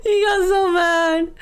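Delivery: high, slow, stretched-out giggly wail; laughing squeal. Real, not acted. Streamer cracking up while telling viewers the story, laughing squeal. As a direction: squeal it slowly through helpless laughter. Laughing over Mia's angry reaction.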